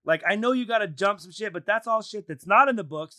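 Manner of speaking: high-pitched exasperated complaint; pissed-off rant. Real, not acted. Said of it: "I'm fucking pissed".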